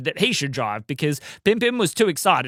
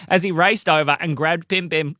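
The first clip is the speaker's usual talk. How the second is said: ordinary delivery, nothing marked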